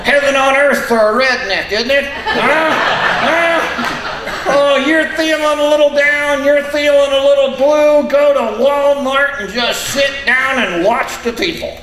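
high, squeaky puppet voice; squeaky ventriloquist dummy. Acted.

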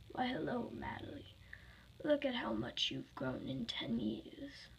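slow, hushed, whispery menace; whispery villain toying with a victim; sinister villain greeting. Acted. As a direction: creep softly through a mock welcome. Villain Golden Freddy greets his victim.

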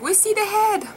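high, excited call; excited announcement. Retold or imitated. Birth team telling labouring mother they see the head, excited. Retells staff calling out excitedly.

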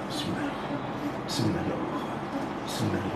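very soft murmured bismillah; hushed prayer. Real, not acted. Healer murmuring softly over the patient. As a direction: breathe the words almost silently.

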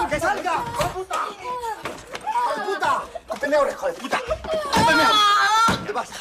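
very loud, very high raging yells; father chasing his son in a rage; frenzied screaming. Acted. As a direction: hunt him down screaming. Father chases hiding son.

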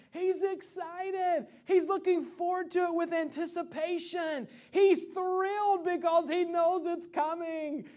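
high, drawn-out relish on each phrase; thrilled anticipation. Retold or imitated. Father describing son's birthday anticipation, thrilled. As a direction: stretch the words with mounting thrill.

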